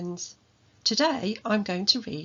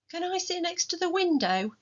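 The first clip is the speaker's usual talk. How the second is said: put-on much higher, pleading little girl; eager child; 11 semitones higher than usual. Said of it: "Hattie asked".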